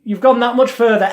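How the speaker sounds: high, pushing encouragement; urging coach voice. Shown as a demonstration. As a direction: coax them further and further. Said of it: "demo of urging subject".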